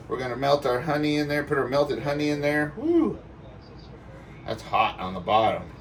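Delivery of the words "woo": sudden high-pitched yelp; high yelp at heat. Real, not acted. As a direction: yelp as your fingers hit something hot. Touches hot honey container.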